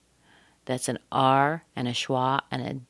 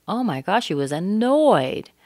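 ANNOYED punched louder, with a big falling tune; stress on ANNOYED; 4 semitones higher, 4 dB louder than usual. Shown as a demonstration. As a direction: exclaim it, leaning hard on "annoyed". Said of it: "you might say annoyed".

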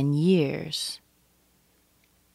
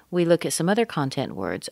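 normal talking voice

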